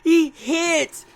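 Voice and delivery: high, loud, stretched-out yell; excited shout. Real, not acted. Fan reliving the punch on Ging, excited yelling. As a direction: shout it like you can't believe it.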